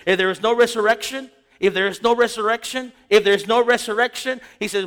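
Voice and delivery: loud, rising preacher's call; preaching at full force. Acted. Preacher pressing the congregation on resurrection, loud and driving. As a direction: drive it home like a preacher in full flight.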